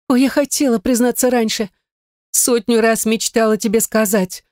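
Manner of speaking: wailing. A narrator's reading. Gayne confesses she long wished to tell, wailing. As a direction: pour it out in a light wail. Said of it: "заголосила Гайне".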